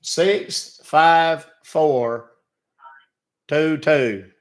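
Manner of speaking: slow, careful number read-back; reading back slowly. Real, not acted. Caller reading code back.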